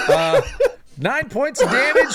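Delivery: high-pitched laughter through the words; laughing. Real, not acted. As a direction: say it while cracking up. Laughing after an edgy joke.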